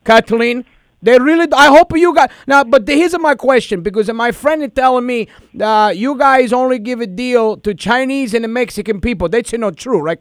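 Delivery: prank caller in a thick put-on Italian accent. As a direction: play an Italian immigrant dad, hammed-up. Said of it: "'Cause I'm Italian".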